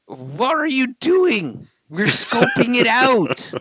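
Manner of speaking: high, slow squeaky voice; slow high-pitched character line. Acted.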